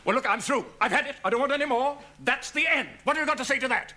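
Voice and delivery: high, fed-up tirade; exasperated rant. Acted. Host scolding his late partner, high and exasperated. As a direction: let him have it, voice climbing.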